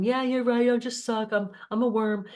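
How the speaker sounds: grovel, mocking the pushover; mock fawning. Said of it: "fawning and being a doormat".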